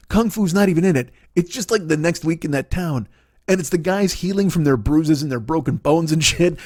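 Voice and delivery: normal talking voice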